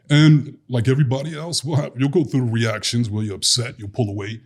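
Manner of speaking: ordinary delivery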